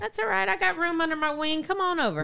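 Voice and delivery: high, cooing fake reassurance; mock comforting. Teasing the co-host with mock comforting.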